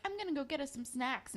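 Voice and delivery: a lightly voiced sweet boy offering snacks. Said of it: "he's like".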